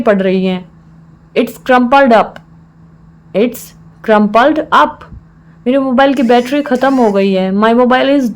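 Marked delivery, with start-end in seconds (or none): 3.4-5.0 measured, with a clear mid-phrase pause; broken into parts. Shown as a demonstration. Teacher repeats the phrase for learners, in separated parts.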